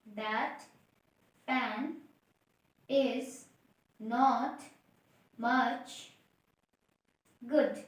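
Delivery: each word said alone with pauses; one word at a time. Shown as a demonstration. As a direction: pause after every word. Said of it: "क्या बताया?". (हर शब्द अलग-अलग रुककर)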